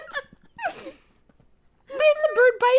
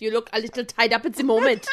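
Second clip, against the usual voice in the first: brisk, low German villain; German accent demo; 12 semitones lower than usual. Shown as a demonstration. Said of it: "Like that".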